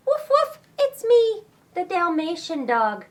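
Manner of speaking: high, loud playful puppy; dog voice. Acted. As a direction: bark it out like a happy dog. Dog reveals itself.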